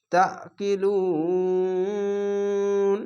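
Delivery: তা কি লুন with the final vowel held very long before stopping; three alif stretch. Shown as a demonstration. Teacher demonstrates the three-alif stretch when stopping. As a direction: hold the last vowel of লুন for three alifs. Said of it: "এভাবে".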